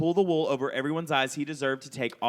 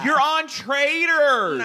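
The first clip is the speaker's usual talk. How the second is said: loud teasing; 12 semitones higher, 8 dB louder, much slower than usual. Real, not acted.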